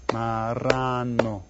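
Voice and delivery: slow, stretched "Marrano"; syllable by syllable. Shown as a demonstration. Teacher asks how many syllables, slowly drawn-out "Marrano". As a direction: draw "Marrano" out for counting. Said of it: "¿Cuántas sílabas hay?".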